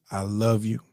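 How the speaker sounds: soft, low declaration of love; calm, tender. Retold or imitated. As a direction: imitate a composed grandfather's farewell. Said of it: "the man was so calm".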